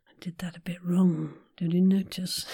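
soft unvoiced whisper. Real, not acted. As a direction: breathe it out. Whispered aside.

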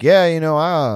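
high, loud, stretched-out stalling; mock chummy hesitation; put-on casual answer. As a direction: act out groping for a safe answer. Acting out his meet-and-greet answer.

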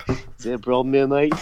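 slightly stronger mock Australian accent; retry the Australian menace line; a hint of Australian enforcer; Australian tough guy. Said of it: "I just sounded like Rocco".

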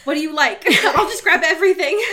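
high, loud, laughing fluster; flustered offer. Acted. Host voices awkward boyfriend grabbing food for her, flustered. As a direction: blurt it out in mock panic, pitch climbing. Acting Edward flustered at lunch.

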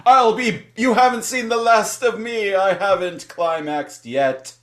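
high, loud theatrical vow; mock villain yell, easing and slowing at the end; defiant falling villain. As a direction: cry out a hammed-up parting threat. Villain's parting cry while falling.

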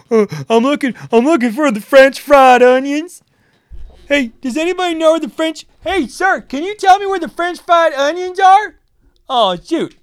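mock shopper lady. Shopper in his way asks for onions, hammed-up high voice. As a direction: ham up a lost shopper calling out. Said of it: "fat Sandy from Ohio get in my way and go".

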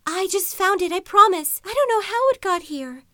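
high, loud frightened pleading; panicked pleading. A narrator's reading. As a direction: beg in a rush of panic. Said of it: "Vivi felt compelled to say".

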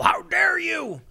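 hammed-up indignant officer; mock outraged shout. Mocking the officer who slammed the table, indignant shout. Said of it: "One of the officers was outraged".

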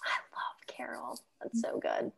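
breathy whispered gush; whispering. Real, not acted. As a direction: whisper it like a secret love. Gushing over a favourite film.

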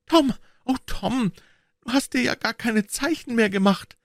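realizing they are lost; high dismayed girl's cry; frightened girl. A narrator's reading. Narrator voices Becky.

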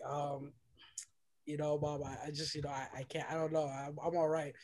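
very quiet, low, halting reply; holding back tears. Retold or imitated. Son can't tell his mom what happened, haltingly. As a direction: hesitate softly, as if choking up.

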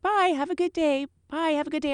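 high singsong attendant farewell; chirpy goodbye. Retold or imitated. Attendant chirps goodbyes to deplaning passengers, high singsong. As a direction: sing the goodbyes on autopilot. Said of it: "so I'm like".

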